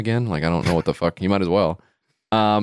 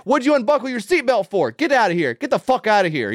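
much higher, squeaky caricature ordering him out; mock furious attendant; 12 semitones higher than usual. Attendant chasing the cab driver away, high mock fury. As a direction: ham up the attendant's paranoid rage. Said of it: "the parking attendant's like".

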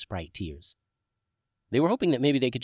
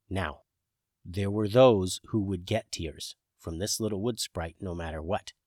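usual speaking voice

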